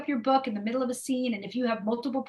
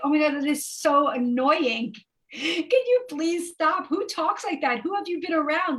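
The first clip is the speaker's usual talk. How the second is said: annoyed partner; 6 semitones higher, 4 dB louder than usual. Retold or imitated. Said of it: "my partner was like".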